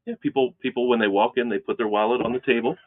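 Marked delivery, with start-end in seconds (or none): 0.0-0.1 playing along in mock agreement, higher pitch; tongue-in-cheek sales tale; sarcastic. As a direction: lay on the sarcasm, lightly. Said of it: "We're laying on the sarcasm".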